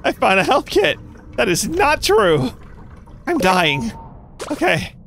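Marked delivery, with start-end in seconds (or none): 0.0-1.0 mock quote. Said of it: "They're in the chat saying".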